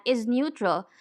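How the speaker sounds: normal talking voice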